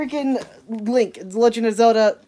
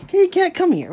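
high sing-song call to a pet; high call to the cat; 8 semitones higher than usual. Real, not acted. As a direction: coax the kitty over, pitched up. Calling the cat.

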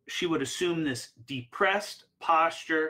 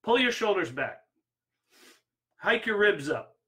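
raised, firm coaching commands; Tony Robbins command; 5 semitones higher than usual. Retold or imitated. Life coach drilling a client's posture, firm and raised. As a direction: bark posture orders at her, pitched up. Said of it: "He goes".

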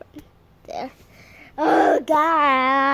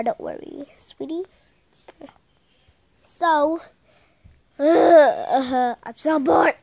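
normal talking voice

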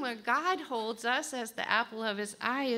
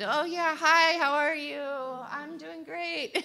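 higher, louder breezy greeting; lightly mocked cheery hello; mock small talk; 7 semitones higher, 5 dB louder, slower than usual. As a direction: imitate someone dodging eye contact. Mimics evasive small talk.

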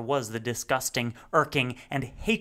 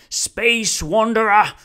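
booming call, much higher and louder, with irritation; slow, loud, impatient summons; peeved call; 9 semitones higher, 13 dB louder, slower than usual. Acted. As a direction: hail him again, now annoyed. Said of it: "called Rumford, and he was peeved now".

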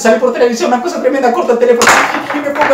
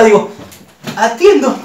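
usual speaking voice

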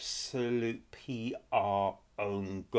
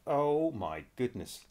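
ordinary delivery

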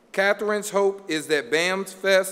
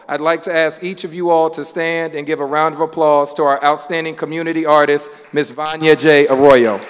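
building louder and louder into the name; announcing the winner; 8 dB louder than usual. Real, not acted. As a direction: call her name out to the room like an emcee. Host calling out award winner.

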